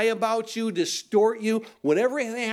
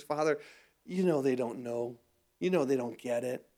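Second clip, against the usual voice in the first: tender plea; 6 semitones lower, 6 dB quieter than usual. Retold or imitated. Jesus pleading for his killers.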